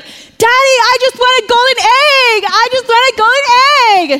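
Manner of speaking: high, loud, drawn-out demand to Daddy; annoying spoiled girl. Retold or imitated. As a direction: mimic a spoiled girl's slow, piercing tantrum. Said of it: "She's annoying the whole movie".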